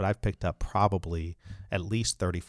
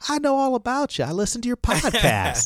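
bad Alabama accent; 7 dB louder than usual. Lawyer imitating a caller from Alabama, hammed-up Alabama accent. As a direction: do your worst Alabama accent, pitched way up. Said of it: "that's my Alabama accent".

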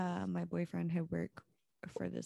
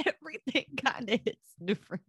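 higher and louder through a laugh; 10 semitones higher, 8 dB louder, faster than usual. Real, not acted. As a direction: say it again while cracking up. Repeats the line, laughing.

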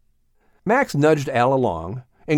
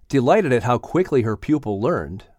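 usual speaking voice

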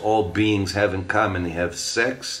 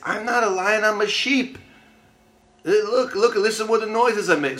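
higher, earnest little lion voice; young lion insisting; 11 semitones higher than usual. Acted. The cub arguing with the big lion that he is a sheep, higher pleading voice. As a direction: plead like a small creature sure it's a sheep.

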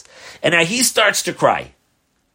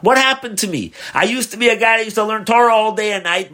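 high, louder self-questioning; anguished lament; 7 semitones higher, 5 dB louder than usual. Retold or imitated. Wagon driver questions his life at night, anguished. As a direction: lament with rising distress. Troubled man's anguished thoughts retold.

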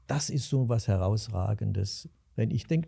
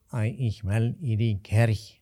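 slow nasal Alsfeld dialect. Retold or imitated. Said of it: "nasale Aussprache und ganz langsam".

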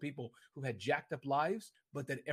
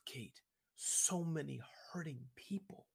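soft whisper, slower; whispered aside; 7 dB quieter, slower than usual. Real, not acted. Guest confides his wish to help hurting people, soft whisper. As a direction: drop to a hushed, slow whisper.

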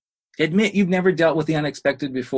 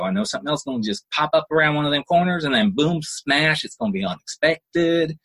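normal talking voice

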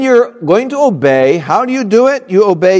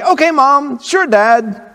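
put-on higher, bright eager child voice; cheerful kid; 9 semitones higher, slower than usual. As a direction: answer mom and dad with a smile. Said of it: "when they see you with a smile, say".

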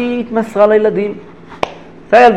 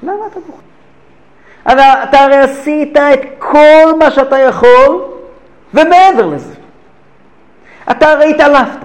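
higher, louder, urgent questioning of a friend; pleading question; 8 semitones higher, 5 dB louder than usual. Retold or imitated. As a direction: press him, why are you crying. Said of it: "שאל רב חיים בריסקר את הרב רש"ב".

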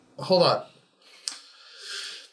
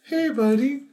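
put-on higher, soothing child-comforting voice; child consolation; 8 semitones higher than usual. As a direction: gently coax as if to an upset child. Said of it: "like, child consolation mode".

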